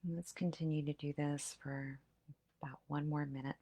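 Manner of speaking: soft, hushed meditation voice; guided breath meditation. Acted. Leads breath-counting meditation.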